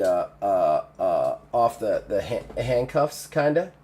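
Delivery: usual speaking voice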